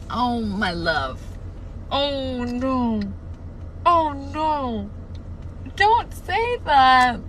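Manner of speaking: slow, swooning wail of dismay; mock anguish. Real, not acted. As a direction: plead with the book, stretching every word. Anguished reaction to character's line.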